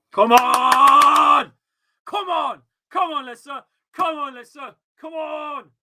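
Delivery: high, loud shouts of come on; fan cheering. Real, not acted. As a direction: roar the team on from the sofa. Cheering his team at a corner.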